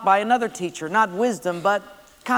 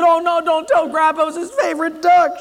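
high, louder panicked begging; frantic little brother plea; pleading boy; 8 semitones higher, 5 dB louder than usual. Acted. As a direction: beg her not to tell. Boy begs sister not to tell.

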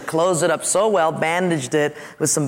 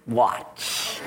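put-on German accent; 8 semitones lower, 6 dB quieter than usual. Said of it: "had this real heavy German accent".

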